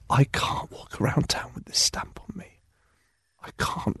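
put-on hushed, breathless whisper of a fussy old woman. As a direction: whisper it as if afraid to be overheard. Old woman hiding her protest.

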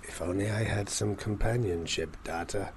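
pine for Data theatrically; lightly voiced lonely captain; mock lonely sigh.

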